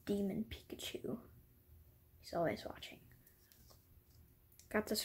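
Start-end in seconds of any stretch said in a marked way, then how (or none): 0.0-3.0 hushed, breathy creepy whisper; spooky whisper. Pretend. Kid whispers a spooky intro about his Pikachu. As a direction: whisper it like a ghost story.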